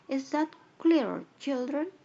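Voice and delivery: high, loud teacher voice to the class; loud teacher question. Acted. Teacher asks the children, loud and high. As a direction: ask it like a teacher calling across the room.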